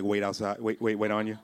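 ordinary talk, nothing marked

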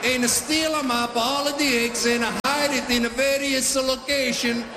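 Italian dad storytelling. Retold or imitated. Italian dad tells the Easter bunny tale in his accent. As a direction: spin the yarn like a dramatic Italian papa. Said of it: "They're dramatic".

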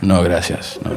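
low, soft broken Spanish; mock broken Spanish, dropped low; Steven Seagal. Seagal turning down a Spanish speaker, low broken Spanish. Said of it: "speaking horrifically broken Spanish".

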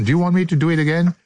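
rapid, rushed question; very fast. Real, not acted. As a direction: rattle it off quickly. Asking again, faster.

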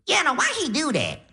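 high, put-on Def Jam comedian voice; crazy Cat Williams accent. Grandma on morphine greets grandson in a high comic accent. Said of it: "talking in a crazy accent".

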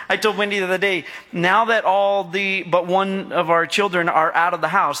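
ordinary delivery

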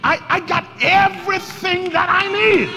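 high, bold shout of confidence; with attitude. Shown as a demonstration. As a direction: shout it like you own it. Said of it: "say that now with your attitude".

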